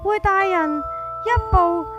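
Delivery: ordinary delivery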